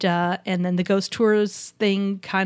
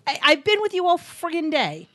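mock exasperated yell; 8 semitones higher than usual. Real, not acted. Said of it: "And stop yelling at me".

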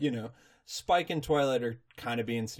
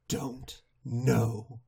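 very slow, spaced-out words; baffled; much slower than usual. Retold or imitated. Recapper plays a pony stumped by thousands of scrolls, baffled. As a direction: leave big gaps between the words.

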